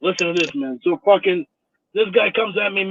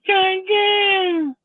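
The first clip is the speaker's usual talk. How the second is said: high, drawn-out squealed imitation; mocking name call. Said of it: "he says my name".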